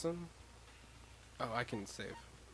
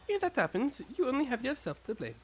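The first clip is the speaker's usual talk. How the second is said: a touch higher, lightly voiced stern girl; stern character voice; 11 semitones higher than usual. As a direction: scold them from above, lightly voiced.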